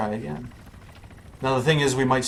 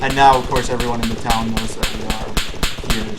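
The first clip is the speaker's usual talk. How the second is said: raised, urgent voice; louder; 7 dB louder than usual. Real, not acted. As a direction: warn that trouble is coming. Enemies alerted in game.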